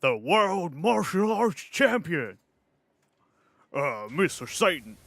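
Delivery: slow, high-pitched boastful champion announcement; Mister Satan. Pretend. Streamer channeling a martial arts champion, slow, high mock boast. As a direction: draw it out high like a showboating fighter. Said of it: "channel, uh, you know, you know Hercule, Mister Satan".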